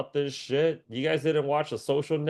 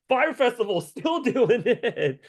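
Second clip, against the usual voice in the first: laughing disbelief; 9 semitones higher, slower than usual. Real, not acted. Laughing disbelief at Fire Festival.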